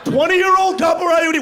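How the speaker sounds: shouted incredulous outburst. Real, not acted. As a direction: yell it in disbelief.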